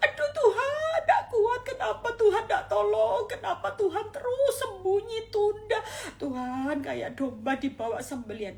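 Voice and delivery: high, loud, sobbing plea; desperate tearful cry, voice cracking high; anguished crying cry to God. Acted. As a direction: wail it out to God in tears and despair. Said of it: "kita teriak gini".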